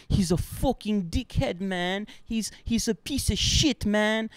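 mock the angry photographer; imitating photographer. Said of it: "And he was like".

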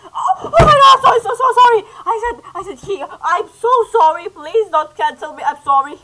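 loud, high, frantic sorry; shouting apologies in real panic; panicked apology. Real, not acted. Panicked apology after slip.

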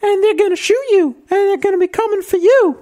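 camp it up as a fretful Southern wife; mock Southern belle. [name] playing Skyler opposite Walt, high mock Southern voice. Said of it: "you be Skyler and I'll be Walt".

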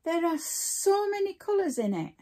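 ordinary talk, nothing marked

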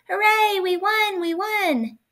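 high, gleeful team cheer. A narrator's reading. Winning team after the rope pull, cheering. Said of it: "The other team cheered".

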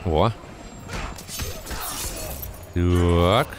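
ordinary talk, nothing marked

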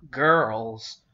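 put-on loud call to stop the girls. A narrator's reading. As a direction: call them to order firmly. Father calls for quiet.

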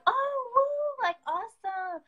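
squealing mock praise for a promotion; mock cheering. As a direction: ham up fake enthusiasm like a fan online. Imitating people cheering on Facebook.

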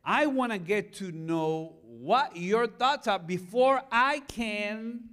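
ordinary talk, nothing marked

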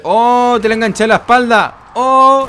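high, loud real whoop of amazement; excited exclamation. Real, not acted. Player landing a shot mid-battle, excited shout. Lands a hook on enemy's back.

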